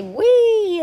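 high, loud roller coaster squeal; gleeful whee. A narrator's reading. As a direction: let out a big high "whee". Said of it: "ready to say".

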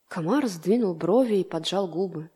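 ordinary delivery, nothing marked

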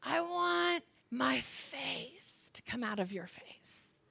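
whispered. Retold or imitated. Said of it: "he's like".

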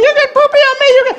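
put-on very high, upset little-girl voice; high-pitched mock kid tantrum; screeching granddaughter. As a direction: imitate a small child shrieking an accusation. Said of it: "she's like".

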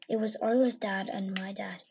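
usual speaking voice

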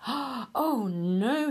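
high startled oh no; dismayed cry. Acted. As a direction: cry out in alarm. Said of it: "he cried".

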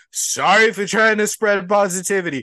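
sarcastically sweet, fake cheerful; sarcastic. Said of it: "And you're like".

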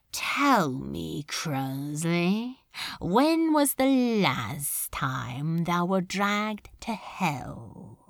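low, slow demon lord, coldly pleased; Beelzebub, smooth menace. Acted. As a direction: purr the praise like a prince of hell. Beelzebub voiced in the story.

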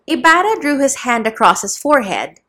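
ordinary delivery, nothing marked

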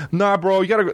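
high mocking bro voice; pushy local. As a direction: mimic the nagging advice. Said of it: "Then I go to that place".

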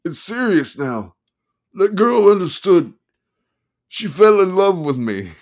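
high, loud manic lawyer; excited manic attorney. Acted. Attorney voiced.

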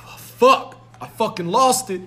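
high, loud cursing as the losing dad; beaten dad. Acted. Son voicing his dad after losing at basketball, high and dismayed. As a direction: imitate a dad's loud dismay. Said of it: "Yeah, he's like".

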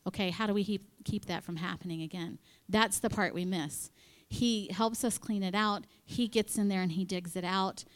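normal talking voice

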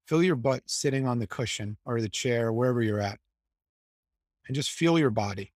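low, even meditation voice with long pauses; guided meditation. Acted. Guiding the group to relax and release tension, calm meditation voice. Leads a guided body scan.

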